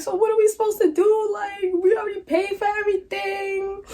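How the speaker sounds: high voice near tears; tearful protest. Retold or imitated. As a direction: cry it out high and desperate. Crying protest.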